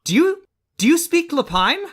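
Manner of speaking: high, hesitant little rabbit voice; high-pitched startled talking bunny; surprised rabbit. Acted. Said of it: "this rabbit looks surprised".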